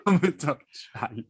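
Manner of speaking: put-on playful go at a Scottish accent. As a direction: draw it out in mock Scottish. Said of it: "better with your Scottish accent".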